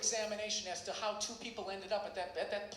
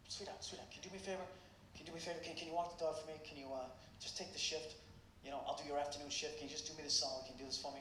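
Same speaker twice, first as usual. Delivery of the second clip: hushed, pleading whisper; 9 semitones lower, 11 dB quieter, faster than usual. Retold or imitated. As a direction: whisper it so she barely wakes. Waking his girlfriend to ask a favor.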